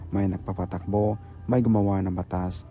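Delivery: usual speaking voice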